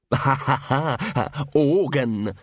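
laugh, then draw out the last word slowly; dotty snigger at a silly word; daft giggling. A narrator's reading. Unhinged bursar sniggers at mention of the organ, giggling.

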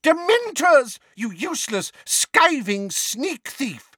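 very high, furious scolding; outraged cry. A narrator's reading. Neighbour berating Mundungus as a thief, furious. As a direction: start piercingly, then drag out the insult. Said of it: "cried Missus Figg".

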